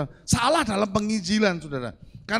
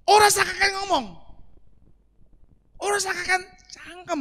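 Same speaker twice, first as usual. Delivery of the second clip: angry Javanese retort; 10 semitones higher than usual. Retold or imitated. Angry man cursing at the evangelist, in Javanese. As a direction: snap back the curse in Javanese. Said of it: "yang diinjili katakan begini".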